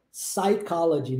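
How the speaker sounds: "psychology" begun straight on the S sound; silent P. Shown as a demonstration. Teacher repeating the English word for students, silent P in "psychology". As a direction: say "psychology" as sai-COL-o-gy, no P. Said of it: "não fala o P".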